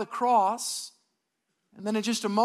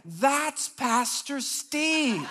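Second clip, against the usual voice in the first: very slow, stretched words, pitched up; drawn-out recognition; 4 semitones higher, much slower than usual. Retold or imitated. Bystander spotting the pastor on the ground, drawn-out recognition. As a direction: imitate an onlooker, dragging out each word.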